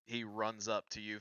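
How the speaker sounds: ordinary delivery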